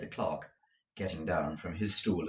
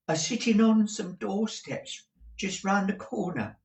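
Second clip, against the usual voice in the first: hesitant client; 11 semitones higher than usual. Acted. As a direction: falter. Mike answering.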